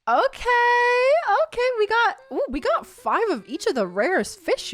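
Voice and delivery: high, loud burst of delight; excited. Real, not acted. Streamer thrilled at the reward, high and loud. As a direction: squeal over the rare fish. Rare fish reward surprise.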